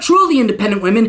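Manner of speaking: ordinary talk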